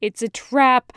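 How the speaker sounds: loud urgent cry; urgent warning. Acted. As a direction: shout the warning in alarm. The voice in his head warns.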